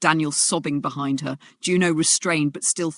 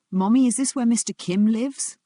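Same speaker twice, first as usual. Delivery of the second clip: put-on a higher little boy's voice, unsure; small boy, timid; 7 semitones higher, 4 dB louder than usual. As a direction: ask like a scared child in a strange lobby. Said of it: "Daniel's voice was barely a whisper".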